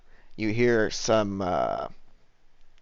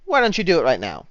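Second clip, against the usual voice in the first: higher-pitched bartender's challenge; put-on innkeeper voice, pitched up; 6 semitones higher, 4 dB louder than usual. Bartender invites him to play right now, higher role voice. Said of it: "she says".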